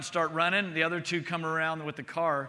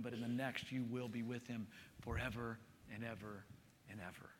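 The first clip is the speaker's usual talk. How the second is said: hushed, intimate sermon voice; soft tender preaching; 5 semitones lower, 12 dB quieter than usual. Real, not acted. Pastor softly telling the congregation of Jesus, hushed and tender. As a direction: speak softly of his love.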